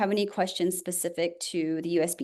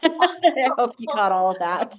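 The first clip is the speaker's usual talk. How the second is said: laughing; 5 semitones higher, 5 dB louder than usual. Real, not acted. Apologizing for speaking fast.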